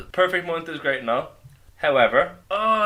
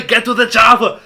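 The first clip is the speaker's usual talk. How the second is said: put-on louder shouted Austrian-accented command; angry Arnie; 5 semitones higher, 10 dB louder than usual. Host showing everyone's angry Arnie, shouted Austrian accent. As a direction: bark it like Arnold in Predator. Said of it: "angry Arnie".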